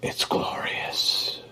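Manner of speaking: whispered in awe; hushed wonder at the pour; awed whisper. Real, not acted. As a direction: breathe it out, amazed.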